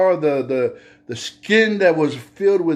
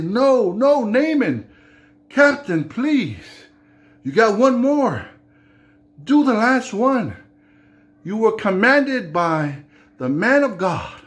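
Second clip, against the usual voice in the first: higher, urgent begging; pleading servant; 7 semitones higher than usual. Acted. Servant pleading with Naaman to dip once more, higher. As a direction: beg the captain to finish.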